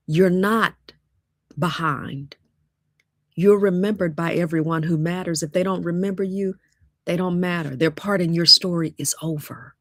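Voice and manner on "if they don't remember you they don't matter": normal talking voice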